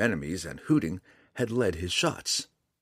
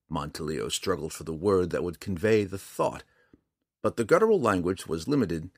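ordinary delivery, nothing marked

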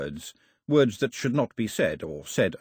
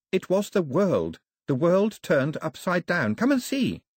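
put-on high girl's voice, eager and emphatic; high-pitched young heroine making a revelation; Daphne, urgent; 8 semitones higher, 3 dB louder than usual. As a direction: build to an excited invitation. Narrator voices Daphne revealing her discovery.

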